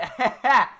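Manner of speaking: high, loud burst of laughter; amused laugh at the bad warp. Real, not acted. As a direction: break into laughter. Laughing at the glitch.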